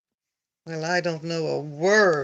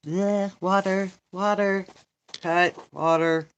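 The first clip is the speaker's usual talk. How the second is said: ordinary delivery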